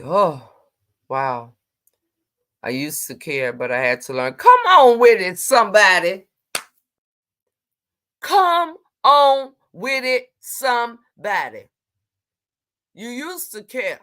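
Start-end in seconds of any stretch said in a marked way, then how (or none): 4.3-6.3 loud shout to the chat; loud "Come on with it" hype; hype call. Real, not acted. Hypes up chat loudly. 8.2-11.7 slow, stretched "Come on with it"; slow, sing-song "Come" stretched; drawn-out call. Real, not acted.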